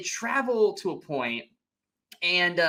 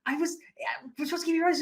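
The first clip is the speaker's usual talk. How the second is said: apologetic Sentinella; 12 semitones higher than usual. Retold or imitated.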